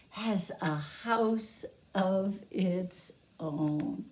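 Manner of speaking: normal talking voice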